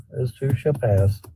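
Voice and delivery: ordinary talk, nothing marked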